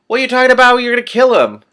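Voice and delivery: high, loud, alarmed shout; alarmed outcry. Real, not acted. A listener shouting that the patrician will kill him, high and loud. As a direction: cry out in real alarm.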